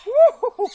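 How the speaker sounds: high loud whoop under the weight; straining whoop. Real, not acted. Keeper whoops while hauling up the heavy python. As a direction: let the effort burst out as a whoop.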